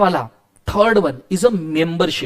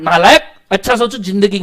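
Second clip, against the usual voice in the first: ordinary delivery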